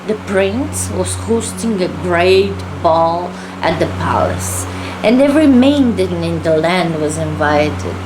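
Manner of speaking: plain reading voice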